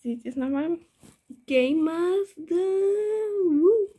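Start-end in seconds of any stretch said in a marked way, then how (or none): 1.4-3.9 excited. Real, not acted. Cheering name.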